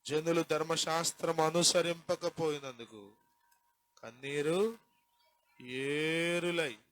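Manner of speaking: slow, solemn reading of the verse; scripture reading. Acted. Preacher reading the psalm verse to the congregation, solemn. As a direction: read the verse with weight.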